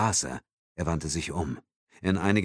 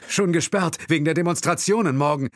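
high, loud and fast; hurried explanation; 11 semitones higher, 5 dB louder than usual. A narrator's reading. Driver quickly explains the closure, high and fast. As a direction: rush out the explanation. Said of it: "erklärte der Fahrer".

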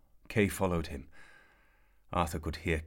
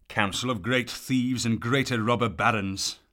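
louder, higher scornful growl; angry scorn; 6 semitones higher, 8 dB louder than usual. A narrator's reading. Sir Ector scorning the council, loud and contemptuous. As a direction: spit out the contempt. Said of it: "he was so angry".

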